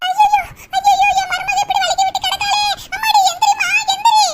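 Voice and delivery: high-pitched panicked fussing; dramatic high wail of dismay; alarmed cry. Acted. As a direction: cry out in alarm over an injured loved one. Mother-in-law alarmed at the fall.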